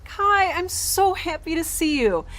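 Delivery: high, delighted greeting; joyful. Acted. Greeting a repaired robot friend, overjoyed and high. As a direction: burst out with happiness at seeing him.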